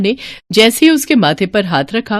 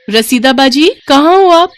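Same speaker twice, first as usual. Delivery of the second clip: high, loud searching call; 8 semitones higher, 6 dB louder than usual. Acted. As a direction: call from outside, rising on the question. Calling out for Rasida. (ऊँची आवाज़ में पुकारना)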